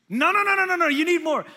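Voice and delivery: loud, high insistent parent; insistent arguing. Acted. Parents pushing food on a dinner guest, insistent arguing. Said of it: "They'll argue with you".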